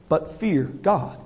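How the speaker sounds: fear God dropped low and soft; a solemn hushed warning; low, slow and hushed. Shown as a demonstration. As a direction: let fear God sink almost to a whisper. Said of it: "Think about that".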